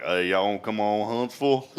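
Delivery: a touch of Texas twang on y'all and Huntsville; light Texas twang. Shown as a demonstration. Host inviting listeners in a light Texas twang. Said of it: "if I'm feeling kinda a little twang".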